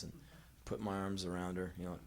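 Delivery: ordinary talk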